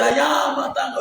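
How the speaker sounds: imitating the actress. Vlogger repeating the actress's line, pitched high. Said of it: "as she say".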